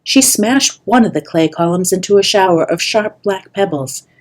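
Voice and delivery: usual speaking voice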